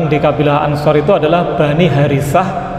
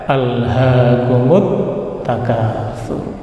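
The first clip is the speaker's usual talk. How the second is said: slow, low, measured Quran recitation; Quranic recitation; 4 semitones lower, much slower than usual. Real, not acted. Preacher quoting the revealed verse, slow low recitation. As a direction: chant the verse slowly, softening at the end.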